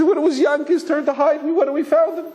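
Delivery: mock child's wail, very high-pitched; crying little boy. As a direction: carry on like a tearful little boy. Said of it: "carrying on".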